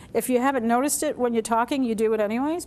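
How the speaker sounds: ordinary talk, nothing marked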